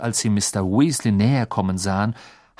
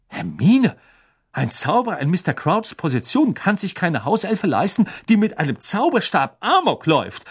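weighty, lecturing, louder; self-important; 8 semitones higher, 4 dB louder than usual. A narrator's reading. Official-minded brother lecturing the girl, self-important. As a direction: speak with pompous gravity. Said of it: "mit gewichtiger Miene".

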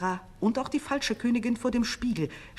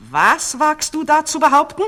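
high, much louder furious shriek; 10 semitones higher, 14 dB louder than usual. A narrator's reading. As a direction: scream it at the intruder. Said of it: "schrie die falsche Königin".

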